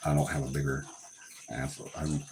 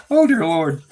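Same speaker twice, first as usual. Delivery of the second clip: higher, worn out from laughing; crying with laughter; 5 semitones higher, 5 dB louder, slower than usual. Real, not acted. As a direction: sigh it out after a real laughing fit. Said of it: "Oh, I'm crying".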